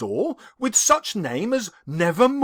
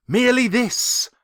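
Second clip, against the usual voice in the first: usual speaking voice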